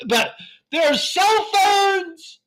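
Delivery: high, loud, slow shout; loud mock-triumphant announcement; mock excited shout. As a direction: proclaim it like great news, hammed up. Mock cheering through history.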